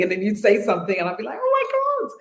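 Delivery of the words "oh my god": squealed high Oh my God, much higher; delighted little shriek at a cute accent; excited gasp. Retold or imitated. Retold delighted reaction to accent.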